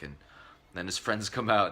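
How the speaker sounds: ordinary talk, nothing marked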